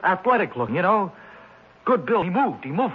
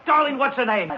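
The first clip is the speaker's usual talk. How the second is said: usual speaking voice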